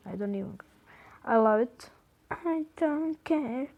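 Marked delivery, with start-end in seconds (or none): 0.1-0.7 breathy whispered aside; hushed playful whisper. Pretend. Playful repeated "I don't care. 2.3-3.7 mock crying. Playful repeated "I don't care.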